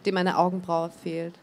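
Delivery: plain reading voice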